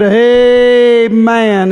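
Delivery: loud, high, drawn-out cry; William Branham's amen. Retold or imitated. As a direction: call it out long and ringing, like a voice from heaven. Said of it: "it was the voice of William Branham".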